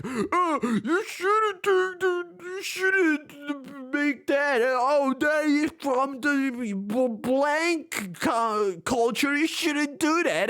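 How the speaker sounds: very high, slow, halting mimicry; mock a preachy scold, dragging it out high; mocking imitation. Mimicking people who police other cultures' food, high slow mocking voice. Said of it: "they always go like".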